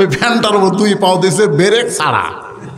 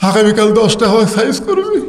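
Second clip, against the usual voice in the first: high, mock-weeping plea to God; imitated tearful complaint; tearful prayer; 4 semitones higher than usual. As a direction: plead through put-on tears. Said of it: "চোখের পানি নিয়ে".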